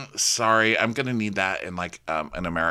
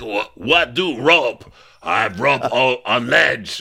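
Mike impression voice; 3 semitones higher, 6 dB louder than usual. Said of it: "Mike's like".

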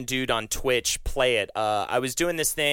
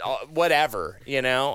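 ordinary delivery

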